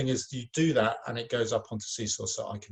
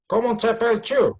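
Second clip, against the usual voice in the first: higher, louder puppet question; 11 semitones higher, 10 dB louder than usual. Acted. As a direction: ask brightly as the puppet. Puppet asks name.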